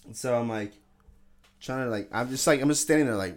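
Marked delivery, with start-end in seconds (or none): none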